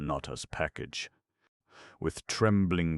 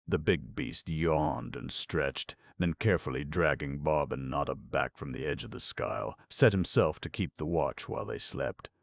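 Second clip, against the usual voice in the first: usual speaking voice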